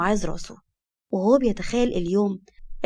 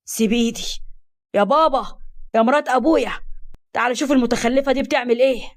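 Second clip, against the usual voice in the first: raised, pitched-up protest; calling for help; 3 semitones higher than usual. A narrator's reading. Stepbrother with twisted arm summons his parents, calling out. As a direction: call out to the parents in alarmed complaint.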